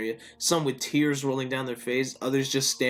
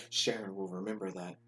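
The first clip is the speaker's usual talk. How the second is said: Telltale game prompt; 3 semitones lower, 9 dB quieter than usual. Retold or imitated. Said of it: "like a, uh, Telltale series".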